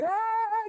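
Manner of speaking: very high, loud squeal; squeal of delight. Retold or imitated. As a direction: let out a sudden high squeal. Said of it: "tiba-tiba ada orang".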